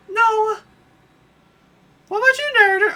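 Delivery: high falsetto princess complaining. Acted. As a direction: squeal like a spoiled princess. Peach voice.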